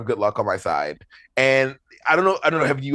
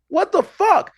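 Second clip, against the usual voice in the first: very high astonished what the fuck; shocked disbelief; 3 dB louder, slower than usual. Retold or imitated. Inmates watching him kick the wall: high shocked squeal. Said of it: "we're like".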